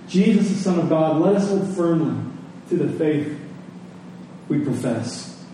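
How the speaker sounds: softly fading sermon voice; a hushed, reverent preacher close; quiet preaching. Acted.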